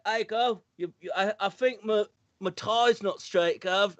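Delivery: imitated Cockney royal. Prince asking his brother about his tie, mock Cockney. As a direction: play it as a chummy Cockney. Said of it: "I'd do Cockney".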